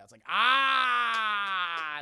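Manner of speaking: a long drawn-out shout on ah; sudden cry. Real, not acted. Streamer cries out mid-recap, loud shout.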